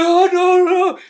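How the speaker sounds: high, loud panicked wail, acted out; mock scared. Retelling a kidnapped woman running out all scared, mock panic. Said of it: "all scared, all scared".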